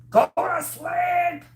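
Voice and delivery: high, slow, loud sung shout; belted out. Acted. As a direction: belt the lullaby line out. Lullaby repeated, belted.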